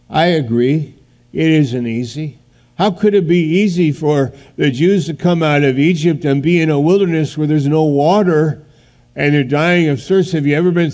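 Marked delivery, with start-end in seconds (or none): none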